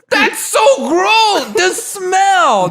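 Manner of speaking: high, slow, loud wail of disgust; hammed-up grossed-out squeal; mock disgusted shrieking. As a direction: shriek it like a revolted schoolkid. Imitating disgusted schoolmates.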